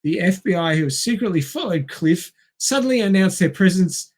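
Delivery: ordinary talk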